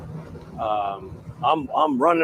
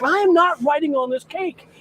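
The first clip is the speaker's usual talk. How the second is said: higher, louder hammed-up refusal; mock defiant shout; 14 semitones higher, 7 dB louder than usual. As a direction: dig your heels in, playing it big. Retold heated refusal.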